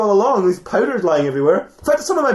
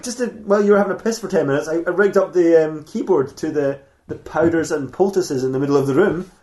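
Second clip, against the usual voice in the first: normal talking voice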